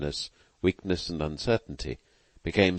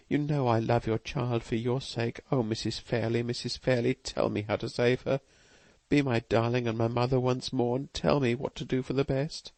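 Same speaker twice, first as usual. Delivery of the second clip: put-on higher, pleading young woman's voice; passionate endearment; 7 semitones higher than usual. A narrator's reading. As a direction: beg the dead mother for help. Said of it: "tones of passionate endearment".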